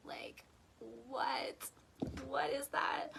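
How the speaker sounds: breathless whispered wonder; awed whisper. Real, not acted. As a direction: whisper it as if stunned by beauty. Awed reaction to the quote.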